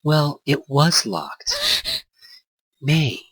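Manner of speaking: put-on girl character voice. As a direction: play the surprised girl caught red-handed.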